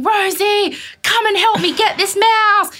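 high-pitched frantic calling; imitated frantic partner. Partner calls her from bed to help, frantic. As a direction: call for help in mock panic. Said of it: "Caleb's going".

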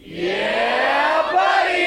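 high excited whoop; excited yell. Acted. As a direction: whoop it up like a fan. Big celebratory shout.

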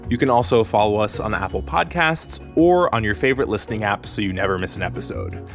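normal talking voice

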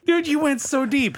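usual speaking voice

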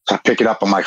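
ordinary talk

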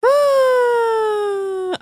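long, high vocal wail of protest; high drawn-out sigh. Real, not acted. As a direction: hold one high exasperated note. Playful protest after being teased.

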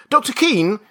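high, loud call for attention. A narrator's reading. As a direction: call out urgently to the doctor. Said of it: "he called".

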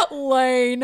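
high, loud chummy "Lane"; imitating husband. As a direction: mimic his buddy-buddy tone. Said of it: "that's how he sounded".

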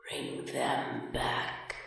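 slow, breathless ghostly whisper; hoarse empty whisper. A narrator's reading. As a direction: rasp it out like a dead captain. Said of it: "hoarse and empty now".

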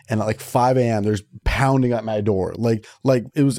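usual speaking voice

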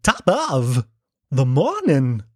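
halting, badly faked Irish brogue with odd pauses; broken-up phrases with sudden pitch jumps; Christopher Walken. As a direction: do a terrible Walken attempting Irish. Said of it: "Christopher Walken as an Irishman".

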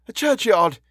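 high-pitched, frightened exclamation; alarmed. A narrator's reading. Policeman backing out of the doorway, alarmed. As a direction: blurt it out in horror. Policeman backs away from the horror.

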